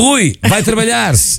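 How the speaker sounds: high-pitched, drawn-out playful rebuke; mock scolding. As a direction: shoo a teasing colleague away in mock outrage. Playful rebuke of teasing colleague.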